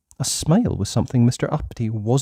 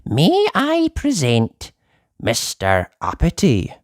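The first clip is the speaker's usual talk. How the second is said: very high, slow squeaky goblin voice; goblin herald; 4 semitones higher, slower than usual. Acted. Goblin announcing to the King, high and squeaky.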